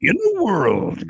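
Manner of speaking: a hint of slow trailer announcer; movie trailer voice. Naming the famous trailer guy, lightly mimicking his announcer voice. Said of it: "he is the movie trailer voice".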